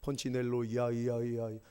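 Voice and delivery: mock echo calling a name, trailing off. Shown as a demonstration. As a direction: let the name ring out and fade like an echo in the hills. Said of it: "이때 에코가 들어가 줘야 되는".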